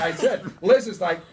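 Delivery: normal talking voice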